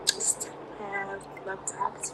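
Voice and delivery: ordinary talk, nothing marked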